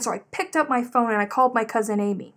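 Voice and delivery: ordinary talk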